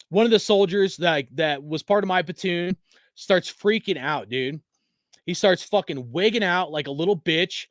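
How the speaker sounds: usual speaking voice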